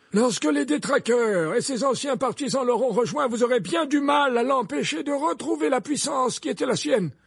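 high, loud, insistent defence; forceful headmaster. Acted.

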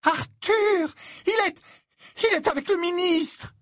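very high, stammering, astonished shout; overwrought cry. A narrator's reading. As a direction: stumble over the shocking news at full pitch. Mother cries startling news to husband. (cri suraigu, bafouillant de stupeur)

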